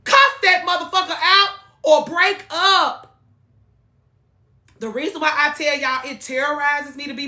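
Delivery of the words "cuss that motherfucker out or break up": higher and louder, a sudden burst; loud outburst. Real, not acted. Urging fans to confront partner.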